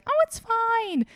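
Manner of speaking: very high, drawn-out singsong; mock breezy reassurance; airy dismissal. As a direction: brush it off in a slow, high-pitched voice. Acting out her past dismissiveness.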